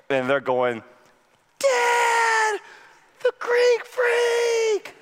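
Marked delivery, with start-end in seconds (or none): 1.6-4.9 put-on excited kid shout. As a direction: yell it like a kid seeing his hero. Sons cheer the star.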